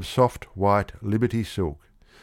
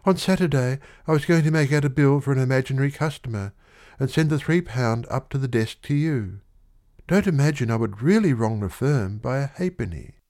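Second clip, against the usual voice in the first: put-on higher, fast young woman explaining; defensive girl; 6 semitones higher than usual. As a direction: insist she meant no wrong. Girl explains her plan.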